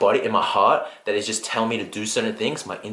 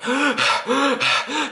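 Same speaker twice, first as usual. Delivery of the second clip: imitated gasp for air; 14 semitones higher than usual. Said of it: "he's, like, huffing and puffing".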